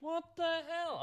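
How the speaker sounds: very high-pitched disbelief; high, incredulous reaction; real bewilderment. Real, not acted. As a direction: squeak it out, baffled. Reacting to the game crash.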